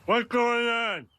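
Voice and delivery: talking with mouth full; words round a mouthful. Real, not acted. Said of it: "Homer, don't talk with your mouth full".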